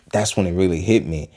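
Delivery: ordinary talk, nothing marked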